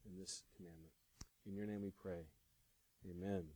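soft, low, unhurried prayer. Real, not acted. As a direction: pray quietly and sincerely, letting phrase ends sink away. Leads the congregation in prayer.

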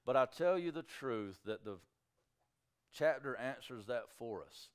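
normal talking voice

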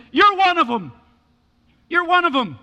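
high, forceful acted accusation; accusing. Servant girl accuses Peter, acted.

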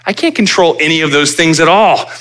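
rising sermon fervour; preaching at full force. Acted. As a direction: drive it home from the pulpit. Preaching climax on control.

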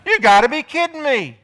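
loud sneering wife scoffing at her husband; scornful disbelief. Retold or imitated. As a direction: scoff at him loudly. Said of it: "That's what she was saying".

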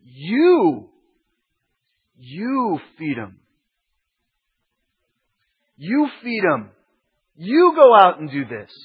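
insist, and keep pressing harder; insistent Jesus. Retold or imitated.

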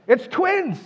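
very high, loud reveal; spring the big news in a high excited voice; acted-out astonished announcement; mock surprise. Acting out a surprise birth.

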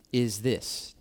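ordinary talk

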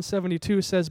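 ordinary talk

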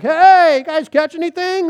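high, carrying call. Retold or imitated. Stranger on the beach hails fishermen in boat, calling out. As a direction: shout across to the boat.

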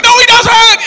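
loud, high contradiction; hammed-up furious rebuttal; mock angry shout. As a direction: shoot it down at full volume. Atheist snaps back at the speaker.

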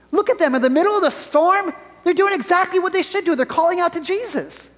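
high-pitched, gushing praise; acted-out wide-eyed enthusiasm; mock admiration. Acts out naive admirer of disciples.

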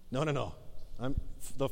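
ordinary delivery

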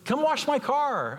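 high slow mock demand to the waiter; mock greedy customer. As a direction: play the pampered customer, hammed up. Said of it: "I'm like".

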